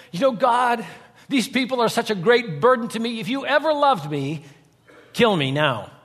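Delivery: high-pitched mock whine to God; whining child. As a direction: plead like an overburdened, whining kid. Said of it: "Moses sounds like a, a whining child".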